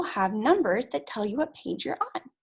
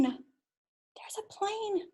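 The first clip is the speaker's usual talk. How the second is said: breathy hushed whisper, drawn out slowly; 9 semitones higher, 10 dB quieter, much slower than usual. Acted. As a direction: whisper it like a secret to little kids. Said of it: "whispered aside to children".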